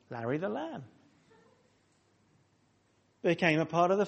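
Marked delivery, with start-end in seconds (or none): 0.1-0.8 soft, fond little nickname; tender pet name. Acted. Preacher voicing a family naming their pet lamb, softly and fondly. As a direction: say it like cooing over a pet lamb.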